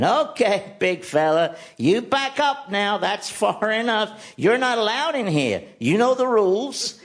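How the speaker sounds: high, slow soothing coax to a bear; friendly, non-threatening. Retold or imitated. Bear expert gently coaxing a grizzly away, high soothing voice. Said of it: "talking to it in a very non-threatening, friendly way".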